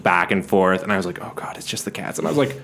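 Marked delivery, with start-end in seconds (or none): 1.2-2.2 scared whisper. Retold or imitated. Scared kid whispering in bed.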